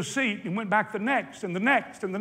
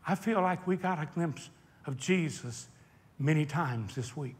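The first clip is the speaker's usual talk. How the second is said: ordinary delivery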